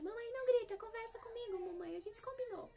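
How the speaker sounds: put-on little boy's voice. Son asking his angry mother to talk instead, high child voice. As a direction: beg mother to stop shouting like a small boy.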